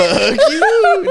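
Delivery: high squeaky voice through laughter; mock laughing voice. Friend mocks the teacher as Resfusion, laughing high. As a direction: squeal it while cracking up.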